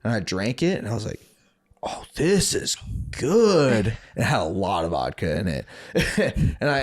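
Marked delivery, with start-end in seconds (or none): 1.8-4.0 drawn-out, higher pleased tone; slow savoring mock delight. Tasting the spiked drink, a drawn-out pleased remark. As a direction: savor it like the first sip.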